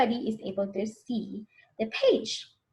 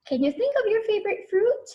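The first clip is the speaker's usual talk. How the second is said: bright teacher question; 10 semitones higher, faster than usual. Real, not acted. Teacher asks the kids, high and eager. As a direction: pitch it up for the class. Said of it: "asking children directly".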